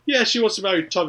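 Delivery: high, loud tattling voice; high auntie voice. Retold or imitated. Her auntie goes to her dad.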